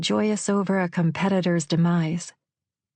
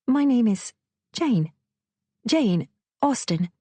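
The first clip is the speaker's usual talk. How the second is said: put-on English accent, starting higher; fake English accent; 6 semitones higher than usual. A narrator's reading. Waitress lying about her name, fake English accent. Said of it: "she faked an English accent".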